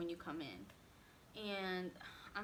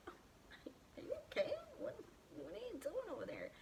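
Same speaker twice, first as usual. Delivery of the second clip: mock shocked guard; 11 semitones higher, 4 dB quieter than usual. Imitating the shocked guard asking if she's okay. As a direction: ham up his startled concern, breathy and high.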